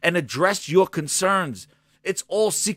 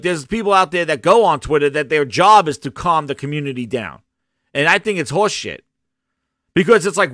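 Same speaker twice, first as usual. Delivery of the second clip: normal talking voice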